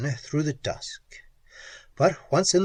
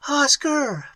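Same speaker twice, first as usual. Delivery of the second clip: screamed name; 13 semitones higher than usual. A narrator's reading. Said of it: "Pat screamed".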